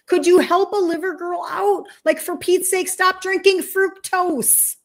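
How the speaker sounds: high, pleading, overworked-organ voice, slowing down near the end; tired liver girl. Acted. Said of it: "And your liver's like".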